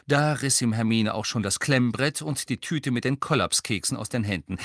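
usual speaking voice

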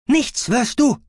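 sharp, loud and high retort; vehement. A narrator's reading. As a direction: cut him off fiercely. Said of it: "erwiderte Bassa heftig".

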